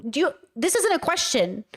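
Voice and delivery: usual speaking voice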